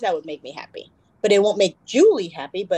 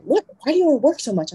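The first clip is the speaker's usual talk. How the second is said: mock-baffled mom, pitched higher; incredulous mom; 7 semitones higher, faster than usual. Mom baffled by daughter loving work, higher incredulous. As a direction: ask it like mom can't believe it. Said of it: "She's like".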